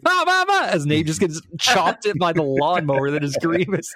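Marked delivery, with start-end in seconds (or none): 0.1-0.7 high, loud imitated screams of a teammate being cut down; mock dying screams. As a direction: squeal like you're getting chopped up. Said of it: "we hear".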